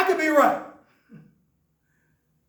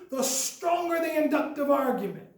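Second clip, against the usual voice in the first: ordinary talk, nothing marked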